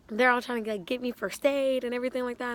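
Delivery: ordinary talk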